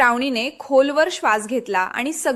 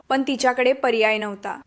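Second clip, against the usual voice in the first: ordinary delivery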